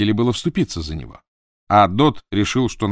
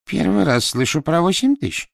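bewildered; 9 semitones higher, 4 dB louder than usual. Acted. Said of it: "растерянно сказала она".

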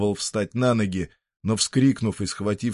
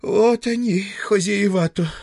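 очень высокий старушечий укор, медленно; сетуй по-старушечьи; голос старушки; 12 semitones higher, 5 dB louder than usual. Acted. (старушка сетует на хозяев высоким голосом)

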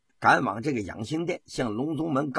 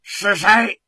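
sharp, loud and high furious question; angry demand; 8 semitones higher, 8 dB louder than usual. A narrator's reading. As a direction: bark it at the trembling eunuchs. 皇帝怒问太监. (皇帝怒喝太监，高声厉问)

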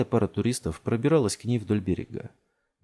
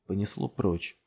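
ordinary delivery, nothing marked

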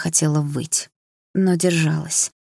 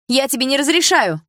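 high loud forbidding shout; mother yelling; 9 semitones higher, 5 dB louder than usual. A narrator's reading. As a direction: shout the order. (мать кричит на дочь, запрещая)

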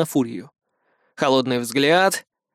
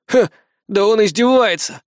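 put-on petulant boy's grumble, pitched up; higher, put-out exclamation; sulky boy; 6 semitones higher, 3 dB louder than usual. A narrator's reading. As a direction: pout and complain like a thwarted boy. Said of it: "накуксился парень".

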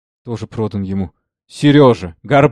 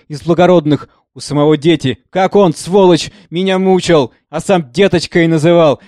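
high, loud, bitter accusing cry; anguished outcry; 6 semitones higher, 7 dB louder than usual. A narrator's reading. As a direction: hurl it at him, shaking with pain. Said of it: "Её голос высоко и жалобно обломился".

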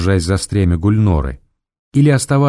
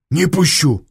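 desperate plea; 8 semitones higher, slower than usual. A narrator's reading. Blocking the door desperately.

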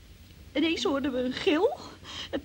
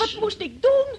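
desperate plea; 9 semitones higher, 7 dB louder, slower than usual. Acted. Cornered by the judge's accusation.

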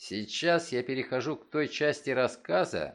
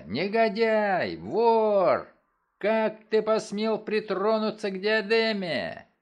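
loud, furious accusation, higher; angry shouting; 7 semitones higher, 5 dB louder, slower than usual. A narrator's reading. Father accusing son over the diadem, furious shout. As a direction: hurl the words at the thief. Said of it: "закричал я".

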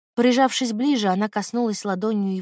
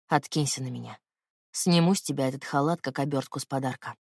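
lightly lowered man's voice, intimate; low male lover voice; 6 semitones lower than usual. Acted. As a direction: speak low as the tender man.

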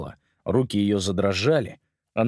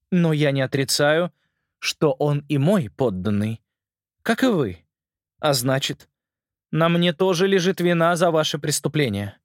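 a hint of a cold, commanding queen; stern queen voice; 7 semitones higher than usual.

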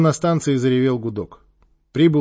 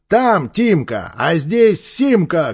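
loud, slow, punchy holler; barked shout; 5 semitones higher, 6 dB louder, much slower than usual. A narrator's reading. Симаков bursting from the bush at the gang, barked shout. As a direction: bark it out slowly and loud. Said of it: "гаркнул".

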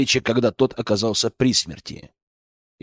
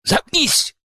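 much higher snarling shout; snarled shout; 14 semitones higher than usual. A narrator's reading. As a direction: snarl it back. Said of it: "прорычал в ответ Акира".

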